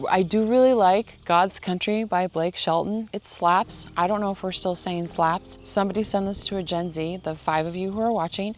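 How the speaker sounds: ordinary talk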